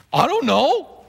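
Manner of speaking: high-pitched cartoonish puzzlement; clueless John. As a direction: pipe it up as a baffled old apostle. Voices John's clueless answer.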